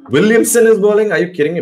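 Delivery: can't believe what you're seeing; disbelief. Real, not acted. Captain unexpectedly bowling himself.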